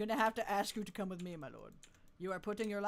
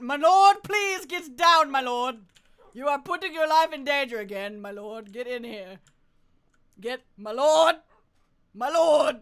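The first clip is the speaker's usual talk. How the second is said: loud, higher calls to the lord; urgent shout; 5 semitones higher, 14 dB louder than usual. Pretend. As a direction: yell at him to get down.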